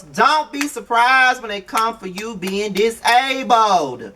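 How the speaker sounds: slow, deliberate warning with each word laid down. Real, not acted. Vlogger slowly warning the reality star who mocked others.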